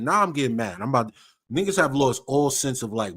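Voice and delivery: ordinary talk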